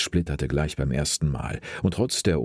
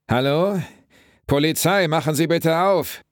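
much higher, louder police call; 12 semitones higher, 5 dB louder than usual. A narrator's reading. Cop shouting at the house door to open. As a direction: bang and shout through the door.

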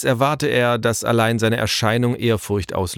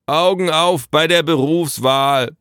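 slow, raised, grumpy call; grumbled retort; 8 semitones higher, 5 dB louder than usual. A narrator's reading. Father dismissing the messenger's complaint, grumbling loudly. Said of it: "brummte Elos".

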